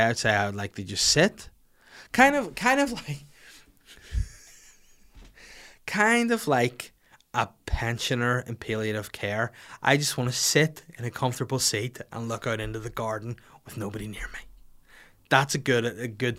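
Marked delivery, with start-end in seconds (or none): 2.1-6.9 put-on old pensioner voice. 13.6-14.4 hushed whisper. Acted. Whispered aside.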